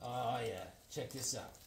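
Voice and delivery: ordinary talk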